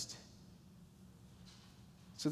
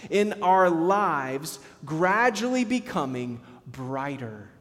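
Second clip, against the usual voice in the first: slow, rising, louder preaching cadence; preaching swell; 4 dB louder, slower than usual. Acted. Pastor to congregation on transformation, slow preaching swell. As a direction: stretch each phrase out like a pulpit climax.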